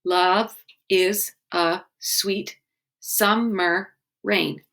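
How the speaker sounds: Love is a sweet summer rain said very slowly, word by word; slow syllable count. Shown as a demonstration. Teacher counting syllables of the haiku line, slowly. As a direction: tap out each syllable slowly. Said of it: "Second line".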